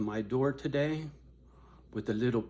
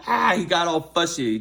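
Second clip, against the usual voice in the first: laughing burst; 5 semitones higher, 8 dB louder than usual. Real, not acted.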